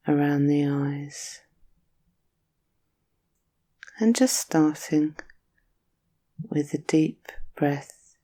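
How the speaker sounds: soothing Yoga Nidra guide; guided meditation. Acted.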